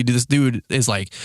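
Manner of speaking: ordinary talk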